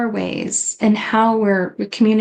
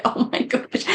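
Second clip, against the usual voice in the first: whispered shock; 2 semitones higher, 4 dB quieter than usual. Retold or imitated. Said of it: "I was like".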